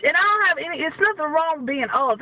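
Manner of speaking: usual speaking voice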